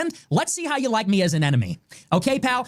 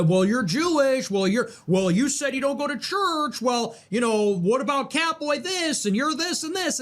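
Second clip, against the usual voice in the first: whiny mock voice; 5 semitones higher than usual. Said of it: "Oh, just, you know".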